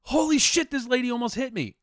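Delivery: retold alarm. Retells alarmed reply to wife.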